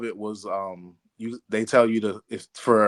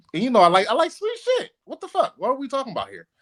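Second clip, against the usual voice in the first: excited squeal; 13 semitones higher, 4 dB louder than usual. Real, not acted. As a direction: voice jumps way up in excited disbelief. Excited rant about sweet pickles.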